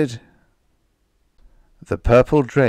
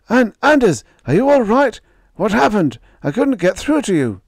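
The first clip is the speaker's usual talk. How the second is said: loud, high, worried call; urgent calling out; 13 semitones higher, 7 dB louder than usual. Acted. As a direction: shout it up high through the static. Radio operator calling pilot.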